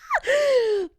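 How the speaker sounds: high shouting laugh; loud laugh. Real, not acted. Laughing loudly.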